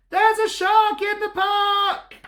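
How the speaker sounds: put-on high, slow childish cry; boy crying out. Timothy crying out about a shark. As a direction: squeal it out like a scared little boy. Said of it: "Timothy yells with all his might".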